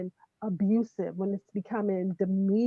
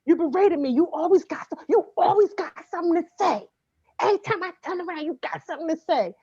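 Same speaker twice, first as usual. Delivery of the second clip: higher, louder accusing voice, fast at the end; accusatory rant; 6 semitones higher, 3 dB louder, faster than usual. Acted. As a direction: throw blame at your partner. Acting out an accusing partner.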